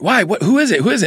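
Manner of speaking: high, rapid bewildered questions; hammed-up baffled reaction; mock confusion. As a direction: act startled and lost. His own startled reaction.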